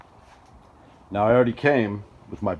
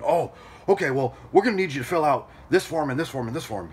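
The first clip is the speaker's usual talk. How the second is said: higher-pitched mock panic of an office clerk; panicking; 5 semitones higher than usual. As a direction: imitate a flustered clerk piling on paperwork. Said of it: "panic, ballistic".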